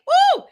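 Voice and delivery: high, loud celebratory woo; excited whoop. Real, not acted. Host hypes up the live class with a loud whoop. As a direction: let out a big hype whoop.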